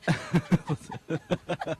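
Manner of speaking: bursting into a snort laugh. Real, not acted. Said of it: "there's the snort".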